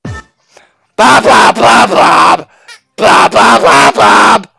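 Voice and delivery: loud, high chant of Bob over music; jingle shout. Acted.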